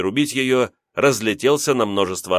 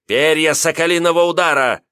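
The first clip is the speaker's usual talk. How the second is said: loud battle cry, higher; shouting; 7 semitones higher, 5 dB louder than usual. Acted. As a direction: yell the attack name. Youth shouts another technique name.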